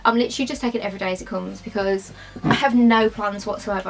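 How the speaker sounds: ordinary talk, nothing marked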